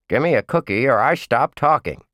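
high cheeky puppet threat; bratty dummy bargaining; ventriloquist dummy. A narrator's reading.

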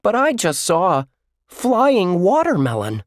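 put-on high, bright amazed kid voice; can't believe what he just saw; excited kid. A narrator's reading. Josh excited, flying watermelon.

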